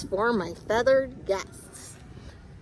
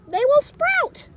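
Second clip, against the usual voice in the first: 1 excited explaining; 8 dB louder than usual. Real, not acted.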